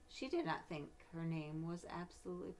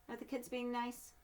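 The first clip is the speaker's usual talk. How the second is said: ordinary delivery, nothing marked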